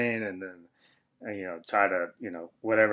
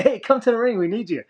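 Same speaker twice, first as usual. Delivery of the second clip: urgent request; 13 semitones higher, 4 dB louder than usual. Retold or imitated. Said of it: "so he said".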